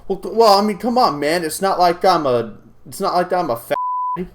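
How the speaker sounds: high, loud mocking voice of the groping coworker. Coworker brushing off his complaint, mocking high loud voice. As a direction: sneer it out like the defensive jerk. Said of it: "throws the F-bomb out and is like".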